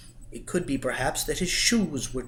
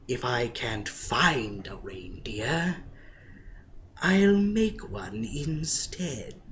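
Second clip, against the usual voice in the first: slow scheming whisper; slower than usual. Acted. Grinch quote, whispered slowly.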